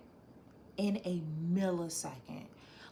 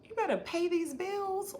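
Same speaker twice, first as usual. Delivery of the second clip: high playful warning; mock scolding; 12 semitones higher, 4 dB louder than usual. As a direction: tease like a bossy friend. Reading a comment in playful voice.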